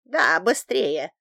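loud, drawn-out grumpy order; gruff grumble. A narrator's reading. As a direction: grumble it impatiently as an old man. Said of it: "буркнул Салов". (ворчливо, громко, с нетерпением)

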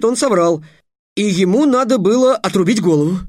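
put-on a boy proudly laying out his logic; eager boy. A narrator's reading. Trix explains the riddle. (взволнованный мальчишеский голос, выше и громче)

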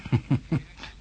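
soft breathy laugh, rising; quiet superior chuckle; dismissive laugh. Acted. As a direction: scoff at the obvious trap. Professor laughs off the trap.

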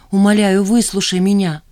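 loud imploring request; pressing, insistent appeal; urgent plea. A narrator's reading. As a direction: beg to be heard. Begging him to listen.

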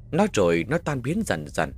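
usual speaking voice

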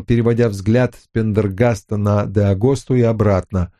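ordinary delivery

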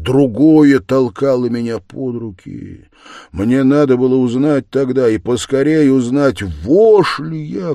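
impassioned, surging confession, dropping at phrase ends; tormented murderer confessing. A narrator's reading. Murderer explaining himself to Sonya, tormented and impassioned. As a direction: pour it out feverishly, loud bursts on key words.

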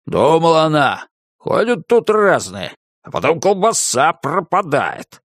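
muttered through a mouthful; mouth full. A narrator's reading. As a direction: mumble with a mouth stuffed with sausage. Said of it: "пробормотал набитым колбасой ртом".

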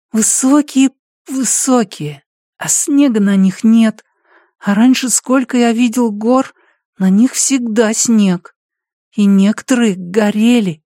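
put-on half-asleep little boy; sleep talking. Boy tells his dream.

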